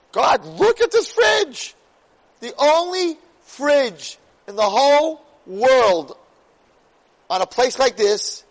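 high, loud, slowed-down exclamation; triumphant cry to God. Retold or imitated. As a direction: call up to God, stretching the words loudly. Retold triumphant plea to God.

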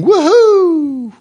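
a high, loud, gleeful whoop; joyful whoop. Retold or imitated. Swimmer with a surfboard enjoying the waves, whooping with glee. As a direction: whoop like you're riding the waves. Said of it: "one of them is going".

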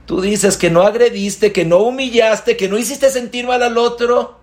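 building indignant challenge; rising rebuke. Real, not acted. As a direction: confront the listener. Preacher rebukes listener.

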